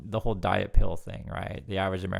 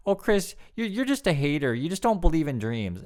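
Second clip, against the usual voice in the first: mocking kids; 7 semitones higher, 4 dB louder than usual. Kids mocking Chris as a hater.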